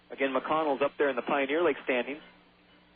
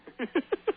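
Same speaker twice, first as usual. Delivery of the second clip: high staccato alien chuckle; put-on puppet cackle; character laugh; 12 semitones higher than usual. Alf's laugh closing the impression.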